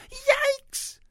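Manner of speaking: very high, loud startled yelp; shriek. A narrator's reading. Ruby comes nose to nose with three snouts, shrieking. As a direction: shriek it out at the top of your range. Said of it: "shrieked Ruby".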